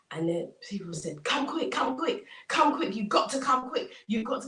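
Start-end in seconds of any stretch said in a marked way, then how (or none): 1.2-4.5 higher, urgent summons; acted excited calling. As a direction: rush them out of the house. Neighbours urgently summon the farmer.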